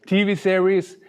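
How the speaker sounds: ordinary talk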